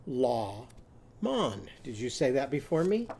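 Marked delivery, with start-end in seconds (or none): 0.1-1.7 "La mon" in two separated syllables, both with an open ah; changed vowels. Shown as a demonstration. Teacher modelling lemon with Ostrich's sound, "La mon" split with ah vowels. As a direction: say "La mon" apart, ah in each half. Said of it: "what is a lemon going to be when Ollie Ostrich eats it?".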